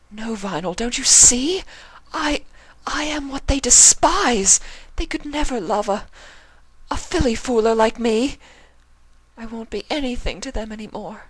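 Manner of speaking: anguished protest; despairing Octavia. Acted. Octavia insisting to Vinyl her parents will despise her, anguished.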